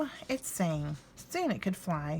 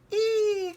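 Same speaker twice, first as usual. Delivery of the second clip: high, loud frightened shriek; startled squeal; 13 semitones higher, 5 dB louder than usual. A narrator's reading. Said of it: "cried the goblin".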